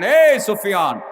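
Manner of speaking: high, forceful scolding; stern roaring rebuke. Acted. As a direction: roar the name and order him off. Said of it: "কঠিন হুংকার দিয়ে ধমক দিয়ে".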